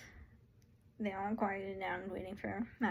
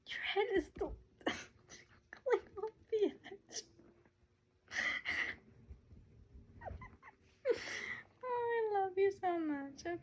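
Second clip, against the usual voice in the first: high doting voice; 9 semitones higher, slower than usual. Real, not acted. Reader tells him she loves him so much, high doting. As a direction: coo it slowly, far above your usual pitch. Doting on someone mid-reading.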